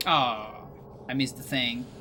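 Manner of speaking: usual speaking voice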